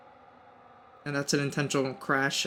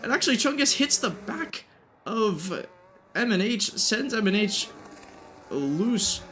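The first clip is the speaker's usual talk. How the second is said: higher, more excited race call; motorsport commentator; 6 semitones higher than usual. Real, not acted. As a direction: call the crash live, building excitement. Battle and crash called live.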